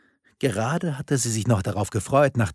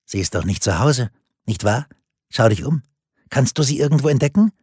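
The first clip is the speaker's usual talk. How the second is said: leicht spöttisch; 4 semitones higher, 6 dB louder, faster than usual. A narrator's reading. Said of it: "Die Stimme klang leicht spöttisch".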